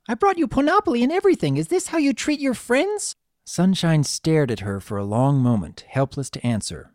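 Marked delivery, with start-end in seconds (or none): 0.1-3.2 high, rushed filly tirade; indignant child. Acted. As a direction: scold your friend in a high child's voice. Filly scolding sick friend.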